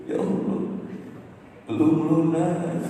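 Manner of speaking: choked whispered plea to God; half-sobbed lament over the debt; crying prayer. Retold or imitated. Husband alone on the field, crying out to God. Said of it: "Baru saya nangis keras-keras".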